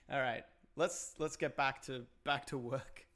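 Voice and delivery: ordinary talk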